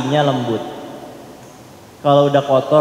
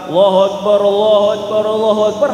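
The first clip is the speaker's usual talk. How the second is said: mindful dhikr; 3 dB louder, faster than usual. Shown as a demonstration. Preacher showing dhikr with a present heart, earnest. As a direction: say it present, feeling the meaning. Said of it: "hati kita itu hadirkan makna".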